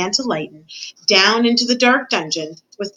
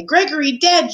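higher-pitched, lightly exclaimed shock; mild shout; 7 semitones higher than usual. A narrator's reading. Cook reporting a death, mildly shouted. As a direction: voice a contained shout. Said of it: "shouted the cook".

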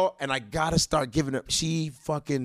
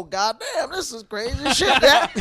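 laughing disbelief; 9 semitones higher, 7 dB louder than usual. Retold or imitated. Retelling his own reaction, laughing.

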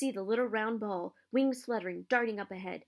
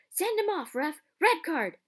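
much higher and louder, furious demand; yelling; 8 semitones higher, 6 dB louder than usual. Acted. Dean yelling at the referee from the stands, angry shout. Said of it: "Dean Thomas was yelling".

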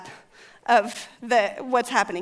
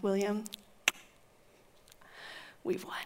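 very soft, low victory whisper; whispered triumph; 3 semitones lower, 13 dB quieter than usual. Acted. Wife whispering victory to husband, very hushed. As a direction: savor the win almost silently.

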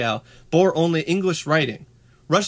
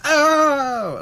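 mock yelp of pain; 12 semitones higher, 5 dB louder than usual. Imitating kid hurting himself.

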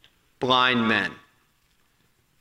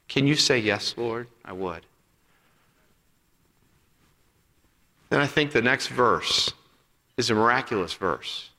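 softer, lower tender preaching; intimate appeal; 5 semitones lower, 5 dB quieter than usual. Acted. Pastor voicing Jesus's question to each listener, soft and tender. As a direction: draw them in close, gently.

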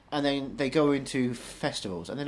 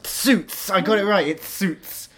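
excited; 8 semitones higher, 9 dB louder, slower than usual. Real, not acted. Triumphant guess is right.

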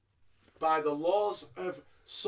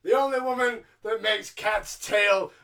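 mock announcer shout; 3 semitones higher, 9 dB louder, slower than usual. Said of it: "he went".

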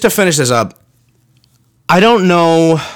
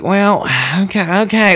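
hesitant shop lady; 4 semitones higher than usual. Retold or imitated. Baker caving to customers, reluctant and hesitant. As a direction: give in grudgingly, dragging it out. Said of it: "And she's like".

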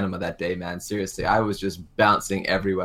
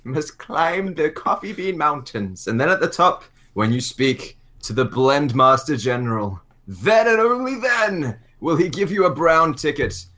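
slow, solemn mystic guide; mock wise sage; 5 semitones higher than usual. Telling the guest how to reach the podcast, slow mock-mystic sage. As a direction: intone it like an ancient mountain master. Invents a mystic quest on the spot.

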